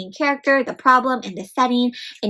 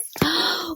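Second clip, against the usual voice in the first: mock gasp; 6 semitones higher, 5 dB quieter than usual. Teacher reacts to story.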